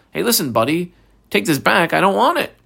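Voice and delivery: lightly acted "not interested" retort; mock dismissive refusal. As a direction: act out handing it straight back. Acting out woman refusing the money.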